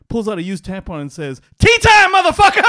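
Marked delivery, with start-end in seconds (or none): 1.6-2.6 high, loud triumphant yell of the punchline; shouted punchline. Acted. Vampire yelling the punchline at the bartender, loud and high. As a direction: bellow it out as the big payoff.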